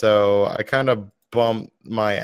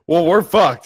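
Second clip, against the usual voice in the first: mock quoting; 6 semitones higher, 7 dB louder than usual. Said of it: "all I hear about is".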